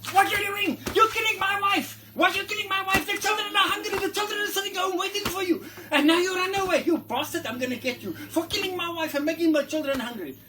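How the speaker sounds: lion father raging at the hunter, shouting; furious daddy lion. Retold or imitated. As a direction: yell it at the man who killed his wife. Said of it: "the daddy lion says".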